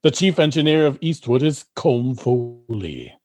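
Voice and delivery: ordinary talk, nothing marked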